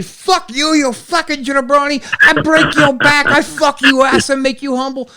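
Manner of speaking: high, slowing mock threat; Iron Sheik rant. Sheik replying to Rob on Twitter, mock rant. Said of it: "one of his crazy rants".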